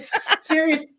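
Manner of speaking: very high-pitched, squeaky disbelief; retold stunned squeal of outrage; incredulous. Builder reacting to bunny ears on TV, incredulous.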